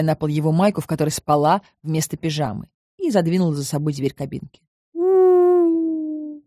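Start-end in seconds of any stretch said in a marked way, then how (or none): 4.9-6.4 high, long impressed "oooh"; respectful drawn-out ooh. A narrator's reading. Husband outside the shower cabin, respectful drawn-out ooh. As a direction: draw out a high, admiring hoot. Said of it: "уважительно протянул Макс".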